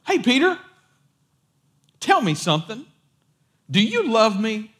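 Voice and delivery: high, lilting call then a question; imitating a casual, leading question; acted friendly questioning. Voices Jesus calling Peter, asking.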